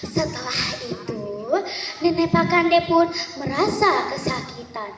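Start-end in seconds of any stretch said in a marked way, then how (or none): none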